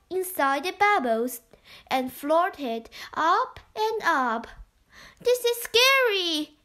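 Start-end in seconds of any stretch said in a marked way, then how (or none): 5.2-6.6 put-on higher, scared little girl voice; a small girl, frightened, pitched up; Beth's voice. A narrator's reading. Beth tells the others she is scared, high girl voice. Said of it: "said Beth".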